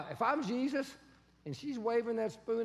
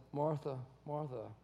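gentle address; 9 semitones lower, 7 dB quieter than usual. Retold or imitated. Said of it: "He just says".

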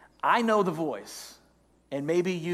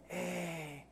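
soft, coaxing tempter's voice; devil on the shoulder; 4 dB quieter, slower than usual. Acted. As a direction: coax quietly, slowing at the end. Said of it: "the second voice jumps in and says".

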